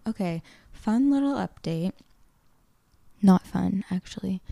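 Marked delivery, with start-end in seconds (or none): none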